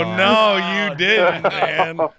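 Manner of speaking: loud, high-pitched protest at a jab; mock-outraged shout. Real, not acted. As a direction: cry out in playful outrage at a friend's tease. Mock outrage at friend's "winners" jab.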